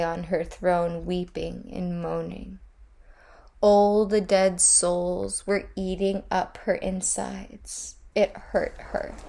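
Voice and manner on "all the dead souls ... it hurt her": ordinary delivery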